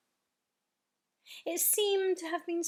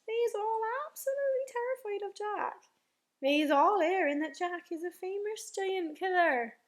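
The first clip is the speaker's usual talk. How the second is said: higher, lilting rustic giant; earnest friendly giant; 6 semitones higher, 5 dB louder than usual. A narrator's reading. BFG telling Sophie of giants' terror, earnest lilting voice. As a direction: explain it with singsong emphasis.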